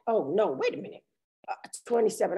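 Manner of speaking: ordinary talk, nothing marked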